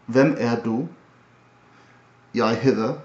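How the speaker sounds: Hvem er du in a light Danish accent. Shown as a demonstration. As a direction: try it the Danish way. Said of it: "Danish is something like".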